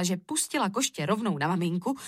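ordinary delivery

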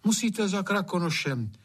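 high-pitched, earnest maid; worried maid, advising. A narrator's reading. Maid sends gamekeeper to Krakonoš, earnest and worried. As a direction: urge him to seek help.

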